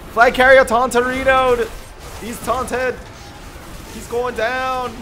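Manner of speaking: high, loud hype yell; real gamer yelling at the kill; excited battle shout. Real, not acted. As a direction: shout the attack in triumph. Hyping attack on flag carrier.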